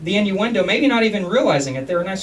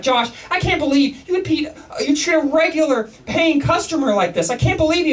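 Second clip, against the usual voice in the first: high, raised mock-furious boss; angry boss; 8 semitones higher than usual. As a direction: scold the employee, pitched up. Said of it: "he's pissed off, he's like".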